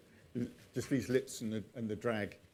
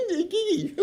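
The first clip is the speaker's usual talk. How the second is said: high, loud giggling Igigi; "Igigi" squeaked out through laughter; laughing mock pronunciation; 8 dB louder than usual. Shown as a demonstration. Mocking the hard-G version, laughing. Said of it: "Which just sounds ridiculous".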